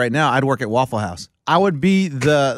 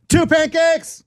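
yelled order; 12 semitones higher, slower than usual. Retold or imitated. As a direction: bark the order across the diner. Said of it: "whoever yelled the two pancakes".

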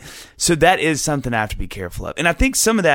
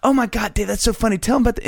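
imitated gushing; 11 semitones higher, faster than usual. Imitates friends gushing over him.

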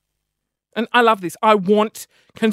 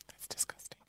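disgusted whisper; 29 dB quieter than usual. Real, not acted. After swallowing snot.

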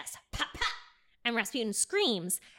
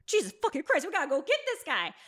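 panicked; 5 semitones higher, faster than usual. Retold or imitated. Panicked murderers.

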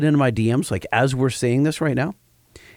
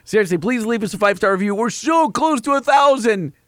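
pleading; 7 semitones higher than usual. Real, not acted. Begging listeners for reviews.

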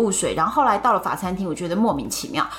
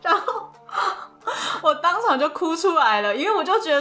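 real tears in a higher voice; choked up; 7 semitones higher than usual. Real, not acted. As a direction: fight back tears while telling it. Tearing up recalling the birthday. (回想生日那晚，哽咽着说)

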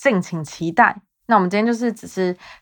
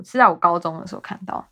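ordinary talk, nothing marked

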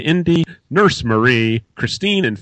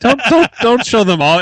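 high, louder teasing protest; playful protest; 10 semitones higher, 6 dB louder than usual. Real, not acted. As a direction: protest it with mock alarm.

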